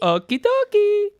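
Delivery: high, slow Mario chirp. Retold or imitated. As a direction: stretch it out like Mario. Echoing Mario line.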